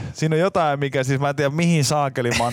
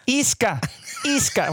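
higher mock calling out iskä; retold call; 10 semitones higher, slower than usual. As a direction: call across the parking lot. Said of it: "sitä huutaa".

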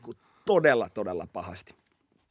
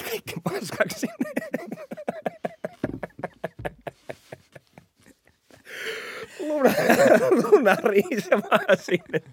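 high laughter breaking through the words; laughing squeal; 14 semitones higher, slower than usual. Real, not acted. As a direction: laugh helplessly while telling it. Laughing through crash story.

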